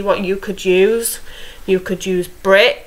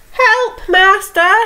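much higher, squeaky little harp voice; harp crying for help; 11 semitones higher, 3 dB louder, slower than usual. Acted. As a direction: cry out like a tiny frightened harp calling its master. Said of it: "the harp cried".

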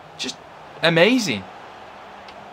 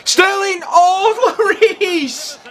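high, loud yell breaking into a laugh; excited shout; 12 semitones higher, 4 dB louder, much slower than usual. Real, not acted. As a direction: scream as the keeper saves. Shot nearly scores, keeper saves.

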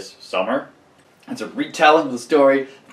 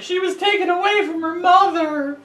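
shrill-free squeaky high mock outcry; a falsetto mock of outraged readers; high mock outrage. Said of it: "They're just like".

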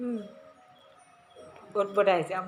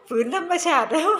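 laughing; 8 semitones higher, 6 dB louder than usual. Real, not acted. Laughing about an odd foreigner.

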